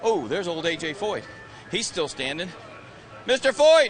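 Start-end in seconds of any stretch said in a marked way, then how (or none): 3.3-3.9 loud, high hail; calling out. Real, not acted. Reporter hailing A J Foyt on the grid, loud call. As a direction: hail him from a distance.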